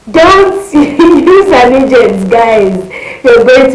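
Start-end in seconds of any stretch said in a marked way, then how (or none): 0.1-2.3 loud, slow, stressed "Don't"; emphatic warning. Real, not acted. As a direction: hammer home the warning. Warning viewers, dramatic.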